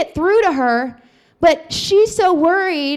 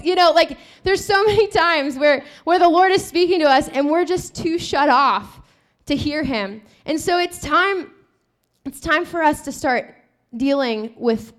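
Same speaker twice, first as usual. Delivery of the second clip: usual speaking voice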